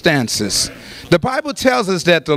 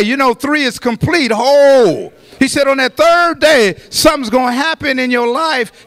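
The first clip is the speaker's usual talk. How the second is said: full-force preaching; 5 semitones higher than usual. Real, not acted. Preacher promises the congregation a third-day healing, impassioned preaching. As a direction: preach it, building to shouted peaks.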